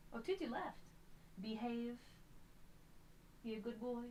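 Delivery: softly coaxing a pet. Real, not acted. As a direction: hush and coax gently. Soft coaxing to cat.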